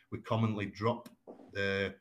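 ordinary talk, nothing marked

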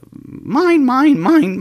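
seagull squawks. Retold or imitated. Imitating Finding Nemo seagulls.

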